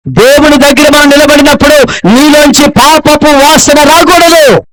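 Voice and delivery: a pastor warning the church about holiness, in an impassioned high shout; fiery preaching. Acted. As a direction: thunder it at the congregation like a revival preacher. Preaching at full force.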